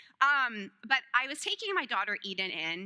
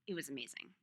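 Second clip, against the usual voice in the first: "It was amazing" dropped lower and softer; hushed, much lower "It was amazing"; soft echo; 9 semitones lower, 11 dB quieter, faster than usual. Real, not acted. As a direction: murmur it back to yourself. Echoed softly.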